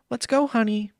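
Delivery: high, tight-lipped mom voice; sweet-stern mom. Pretend. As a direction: chirp it sweetly but firmly.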